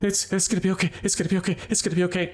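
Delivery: a traumatized guard comforting himself; rocking, self-soothing. A narrator's reading. DM voicing a shell-shocked guard, murmuring. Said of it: "And he's just saying".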